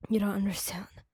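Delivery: low hushed whisper, barely voiced; a tense whisper to his partner. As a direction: breathe it under your breath. Pinned against door, tense.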